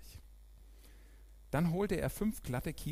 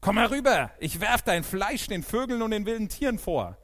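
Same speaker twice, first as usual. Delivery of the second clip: lauter, viel höherer Drohruf; drohend gerufen; 10 semitones higher, 11 dB louder than usual. A narrator's reading. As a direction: schleudere ihm die Drohung laut und hoch entgegen. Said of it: "rief er David zu". (drohend laut gerufen)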